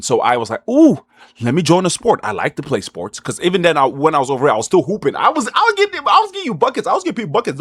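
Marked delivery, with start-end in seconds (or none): 0.7-2.2 excited inner voice. Retold or imitated. Retelling own thought to join sport. 5.2-7.6 excited brag. Real, not acted. Bragging about hooping.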